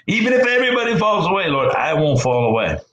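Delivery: high, fast, eager mock boast from Peter; gung-ho Peter. Peter swearing loyalty to Jesus, high fast boast. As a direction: squeak out a brash promise like Peter.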